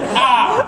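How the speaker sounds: loud, high yelp of fright; mock scream at a scary movie; scared scream. Comedian showing how scary it was, mock scream. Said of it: "the kind of scary where you're like".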